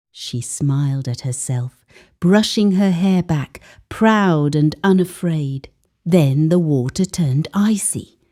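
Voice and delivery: ordinary delivery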